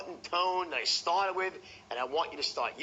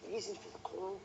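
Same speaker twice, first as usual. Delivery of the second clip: much softer, trailing and intriguing; mystery and intrigue; 9 dB quieter than usual. Shown as a demonstration. Salesman teasing prospect John about the call, softly. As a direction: hold back so they lean in. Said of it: "mystery and intrigue".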